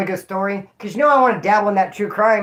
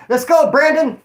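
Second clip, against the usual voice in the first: hyped callout; 7 semitones higher, 5 dB louder than usual. Real, not acted.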